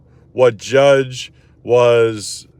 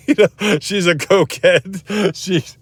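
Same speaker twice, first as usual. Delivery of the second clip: higher pitch, breaking into laughter; laughing; 7 semitones higher, slower than usual. Real, not acted. Lawyer quoting old boss's gossip, laughing. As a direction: laugh through the words.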